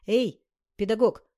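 громкий окрик через зал; небрежно окликнуть слугу; развязный окрик. Acted. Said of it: "бросил он распорядителю". (громкий окрик, выше и громче)

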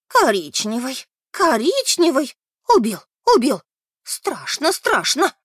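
put-on old lady crying out obsessively; wound-up repeated cries. A narrator's reading. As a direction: repeat it loudly like a wound-up toy. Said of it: "и повторяла, как заведённая". (кричит как заведённая)

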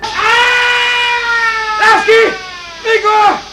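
high piercing alarm scream. Acted. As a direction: yell for help in panic. Alarm over burst pipe.

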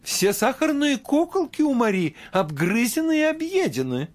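high alarmed exclaim; mother exclaiming. A narrator's reading. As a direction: cry out in dismay. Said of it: "воскликнула мать наутро".

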